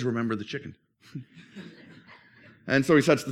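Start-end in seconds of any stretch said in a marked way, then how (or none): 0.0-0.7 low, soft, knowing threat; a quiet blackmailing hint; sly reminder. Acted. Sister reminding brother of the chicken, low and sly. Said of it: "And she says".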